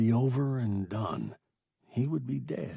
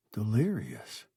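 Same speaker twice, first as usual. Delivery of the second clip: plain reading voice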